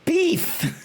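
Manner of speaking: high, laughing yelp of delight; laughing shout. Real, not acted. Reacting to busty character art, laughing shout. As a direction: blurt it out through a laugh.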